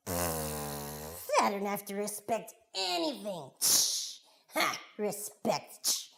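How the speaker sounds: slow, sulky mockery with scornful puffs; scoffing. Little boy dismissing his mother's lesson, slow scoffing. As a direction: drag the words out in contempt.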